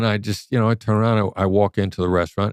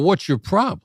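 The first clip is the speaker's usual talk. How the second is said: confrontational; 10 semitones higher than usual. Retold or imitated.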